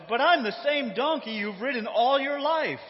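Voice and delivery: high-pitched, protesting donkey character; talking donkey. Acted. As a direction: plead your case as a wronged donkey. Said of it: "The donkey says".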